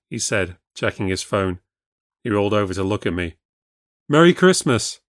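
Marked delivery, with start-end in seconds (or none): 4.1-5.0 higher, louder, cheery Merry Christmas; a chirpy festive greeting. A narrator's reading. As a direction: wish it brightly, with lift. Sam greets at midnight.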